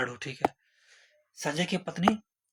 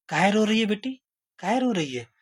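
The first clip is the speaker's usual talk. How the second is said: higher, louder mother-in-law coaxing; mother-in-law asks the crying bride, raised voice; mother-in-law asking; 5 semitones higher, 4 dB louder than usual. Acted. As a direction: ask like a worried mother-in-law. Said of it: "ससुइया जाती है पूछने के लिए".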